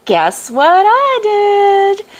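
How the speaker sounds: high, drawn-out singsong tease; excited. Real, not acted. Speaker teasing viewers with her news, excited singsong. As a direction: slowly build up the big reveal, pitch soaring. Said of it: "so excited".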